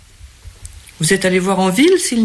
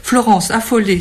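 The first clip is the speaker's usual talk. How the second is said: ordinary delivery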